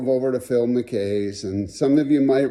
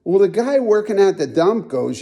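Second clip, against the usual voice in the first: ordinary talk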